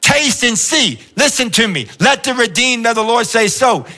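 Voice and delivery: high, slow, fervent preacher cry; full-force closing appeal; preaching climax. Acted. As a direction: drive it home to the room.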